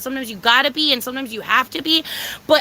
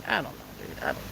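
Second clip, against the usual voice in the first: whisper; 14 dB quieter, much faster than usual. Acted. Repeats, voice dropped to whisper.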